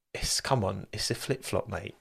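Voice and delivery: mock retort. Repeating his mock Aussie retort.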